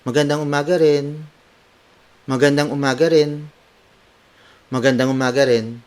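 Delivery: quick, lifted cheery greeting. Shown as a demonstration. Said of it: "apply it in a cheery tone".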